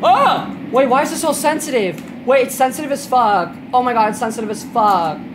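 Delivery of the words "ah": startled yelp. Real, not acted. Falling in game.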